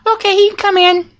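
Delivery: high mock girly voice; girl voice. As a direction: squeak it out like a girl. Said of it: "I did my girl voice".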